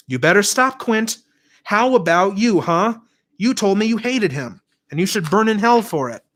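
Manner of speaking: high, pointed threat; angry accusation. A narrator's reading. Vanessa accusing Quint before the class, high angry warning. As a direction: turn the blame back on him.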